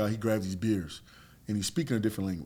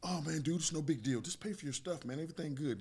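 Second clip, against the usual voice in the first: much softer, placating whine; mock playing victim; 3 semitones higher, 8 dB quieter than usual. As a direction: imitate a guy backing down meekly. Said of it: "he's playing victim now".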